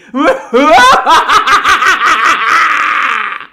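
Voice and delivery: loud, high evil cackle. Acted. Reaper introduces himself with a loud evil cackle. Reaper villain opens with laugh.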